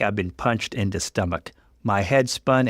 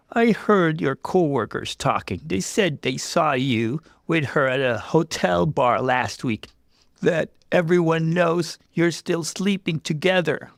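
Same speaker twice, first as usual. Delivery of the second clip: higher, strained accusing voice; upset young woman; 7 semitones higher than usual. Acted. Jess accusing her fiancé, upset and strained. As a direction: lay out the evidence, hurt.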